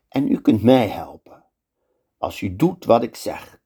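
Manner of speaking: plain reading voice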